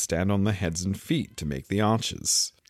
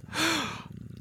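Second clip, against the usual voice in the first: soft, high intake of breath; startled gasp; 6 dB quieter than usual. A narrator's reading. Alice spots a grin in the air, gasping. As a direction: gasp in surprise.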